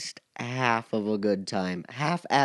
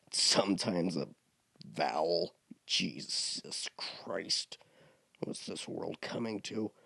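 mock exasperation; 6 semitones lower, 11 dB quieter than usual. Mock-exasperated aside on spelling.

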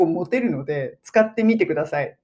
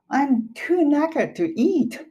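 slow example sentence; 9 semitones higher, much slower than usual. Shown as a demonstration. Example sentence acted slowly.

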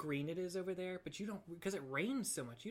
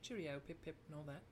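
soft, hammed-up British farewell; British accent; 7 dB quieter, faster than usual. Shown as a demonstration. Co-host parodying British talk, soft British accent. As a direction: toss off stock British phrases in mock accent. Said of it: "I'm gonna do British".

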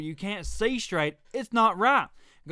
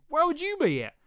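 higher challenge; 10 semitones higher than usual. Shown as a demonstration. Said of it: "Hmm. Where would you be at?".

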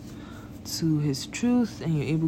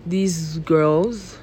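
ordinary talk

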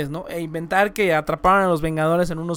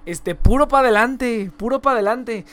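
imitating a northern Mexican speaker; northern Mexican accent; 2 semitones higher, 8 dB louder than usual. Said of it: "hablan más norteño".

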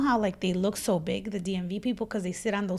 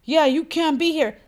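1 puffed-up warning; 4 semitones higher, 4 dB louder than usual. Retold or imitated. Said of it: "kind of like".

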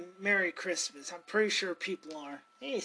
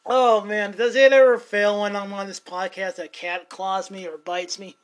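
louder, laughing exasperation; exasperated outburst; 12 dB louder than usual. Real, not acted. Complaining to listeners that the cat always claws him, loud exasperation.

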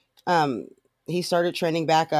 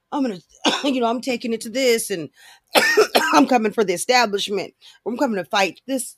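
higher-pitched mimicry of threats; mocking campaign bluster, pitched up; mock combative candidate; 6 semitones higher than usual. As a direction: rattle off threats, mocking him.

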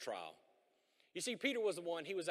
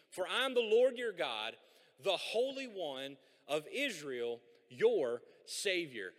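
loud, raised preaching read of the verse; scripture reading; 8 dB louder, slower than usual. Acted. Pastor proclaiming God's promise to the congregation, loud preaching read. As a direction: proclaim the promise slowly and boldly.

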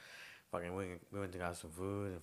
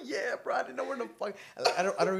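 laughing through the words; giggling high while talking; 14 semitones higher, 9 dB louder than usual. Real, not acted. Laughing while recalling drunk ride.

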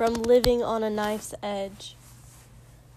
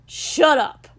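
loud, higher angry cut-off; dismissive spit; 5 semitones higher, 10 dB louder than usual. A narrator's reading. Furious man cutting off the helper, dismissive. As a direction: spit it out to silence him. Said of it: "Katsuki spat dismissively".

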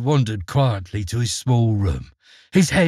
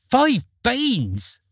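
shrill as a laying hen; shrill shout; 10 semitones higher than usual. A narrator's reading. Mother hearing of the beans, shrill with outrage. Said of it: "her voice as shrill as a laying hen's".

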